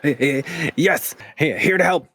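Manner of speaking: high, stammering little dragon voice; a nervous small dragon stammering hello. Acted. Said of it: "I won't keep using the dragon voice".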